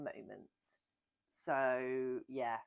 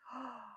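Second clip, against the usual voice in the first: excited gasp; 8 semitones higher, 11 dB quieter than usual. Real, not acted. Excited gasp at food question.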